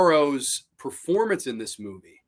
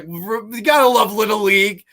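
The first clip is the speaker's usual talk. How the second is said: loud, raised-voice quote; retold coaches' cheer; 7 semitones higher, 7 dB louder than usual. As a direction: call it out high and hearty, imitating buddies. Retells coaches' reaction to his line.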